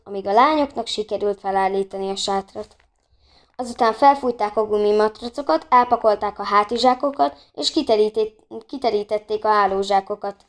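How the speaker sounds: ordinary delivery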